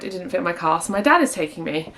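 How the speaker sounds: ordinary delivery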